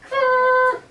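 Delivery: high, loud strained growl; tense growl. Retold or imitated. Little brother straining silently as Gina nears the trap. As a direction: grit it out.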